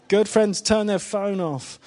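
hammed-up stern reminder; mock stern tease. Real, not acted. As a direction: playfully scold the friend whose phone went off. Teasing friend whose phone rang.

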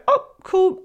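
sudden happy surprise; bright cheerful oh cool. Retold or imitated. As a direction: chirp it like good news. Cheerful reframe of trigger.

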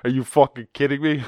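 half-laughing disbelief, building to a loud demand; exasperated outburst. Retold or imitated. Ranting at vaccine-skeptic logic, mock incredulous shout. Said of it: "I'm like".

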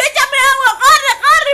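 hammed-up tipsy urgency; mock drunk plea. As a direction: beg for water like your drunk self. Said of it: "Y yo".

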